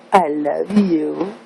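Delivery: tender. Real, not acted. Mother to baby, slow.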